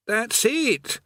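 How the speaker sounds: high, slow, put-upon prisoner voice; a tired old convict grumbling on; weary chained man. Acted.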